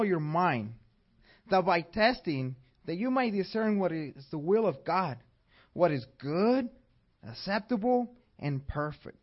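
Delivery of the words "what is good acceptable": ordinary delivery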